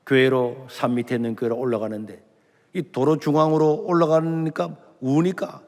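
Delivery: usual speaking voice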